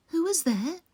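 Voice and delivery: put-on high, frail old woman's call; grandmother calling. A narrator's reading. Grandmother answering the knock, high frail call. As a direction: call out high like a weak granny.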